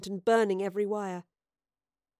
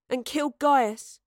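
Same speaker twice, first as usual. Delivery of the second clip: louder, slow, bitter retort; spat; 4 semitones higher, 5 dB louder, slower than usual. A narrator's reading. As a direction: spit the words out in contempt. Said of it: "Astrid spat".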